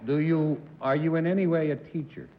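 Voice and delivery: ordinary delivery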